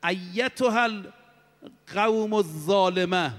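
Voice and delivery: slow, drawn-out solemn proclamation in Arabic; herald's cry. Retold or imitated. Preacher voicing the heavenly herald to his congregation, slow solemn cry. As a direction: call it out slowly like a voice from the sky. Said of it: "اون ندا این بود".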